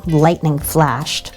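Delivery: plain reading voice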